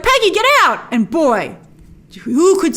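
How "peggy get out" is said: much higher, hammed-up urgent cry; mock urgent warning. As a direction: warn her off in mock panic. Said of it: "And he's like".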